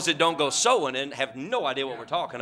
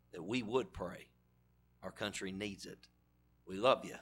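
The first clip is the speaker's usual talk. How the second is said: hushed, earnest prayer voice; low, reverent closing prayer; quiet prayer; 6 semitones lower, 7 dB quieter than usual. Acted. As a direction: pray softly over the congregation. Pastor prays aloud before sermon.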